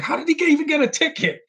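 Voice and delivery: squeaky high mock quote; high cartoonish squeak of disbelief; mock high character voice. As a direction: pitch way up, mocking the character. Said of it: "he's like".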